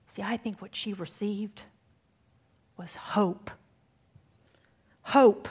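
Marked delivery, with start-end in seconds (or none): 2.8-3.5 whispered hope. Real, not acted. Preacher whispers the key word. 5.0-5.5 proclaimed hope. Real, not acted. Preacher repeats the word loud.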